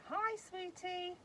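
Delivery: high-pitched, drawn-out sing-song; sing-song call. Shown as a demonstration. Owner triggering the talking baby doll, high sing-song call. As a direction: coo it high and slow to a baby.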